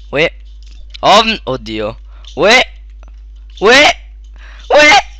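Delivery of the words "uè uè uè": mock infant cry; baby wail. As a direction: wail like a crying newborn, growing. Imitating a baby's cry.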